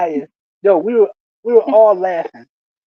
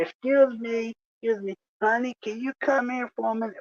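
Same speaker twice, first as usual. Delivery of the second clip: higher, softer coaxing call; mock-polite beckoning; 4 semitones higher, 8 dB quieter than usual. [name] calls the coworker's wife over, mock-polite and coaxing. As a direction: beckon her over sweetly, quickening at the end.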